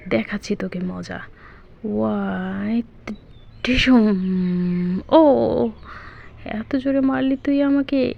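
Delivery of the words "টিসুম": slow, drawn-out punch noise; punch sound effect. Acted. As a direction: stretch the fight noise out.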